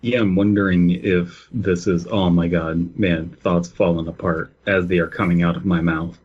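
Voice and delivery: ordinary delivery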